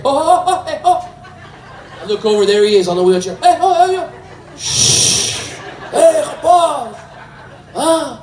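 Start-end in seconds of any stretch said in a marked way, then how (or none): 0.0-1.0 very high comic hooting. Acted. [name] heard from wheelchair. 3.4-4.1 very high comic hooting. Acted. [name] hollers from wheelchair. 4.6-5.5 long, hissed "shh"; exaggerated shush. [name] hushing [name] about the secret, exaggerated shush. 5.9-8.2 high, drawn-out teasing call. Acted. [name] greets him from wheelchair.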